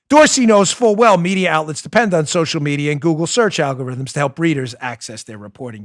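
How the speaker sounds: ordinary talk, nothing marked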